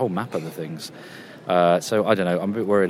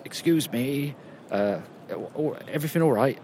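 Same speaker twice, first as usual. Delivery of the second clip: higher, polite Cockney guard asking if all's well; Cockney accent; 6 semitones higher than usual. Retold or imitated. Cockney guard politely checking on an intruder, Cockney accent. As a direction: play a mild Cockney guard checking on you. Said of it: "in a kinda Cockney accent".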